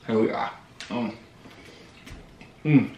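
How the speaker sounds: talking while chewing; muffled reaction mid-bite; mouth full of pizza. Real, not acted. Seen 1: Bites pizza at -1; mouth closed, jaw working through labelled words.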